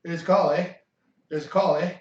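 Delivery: hammed-up Canadian accent ringing. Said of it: "So the Canadian phone".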